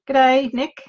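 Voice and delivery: hammed-up Aussie greeting, put on for fun; playful exaggerated Australian accent; broad Australian greeting. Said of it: "I can speak Australian".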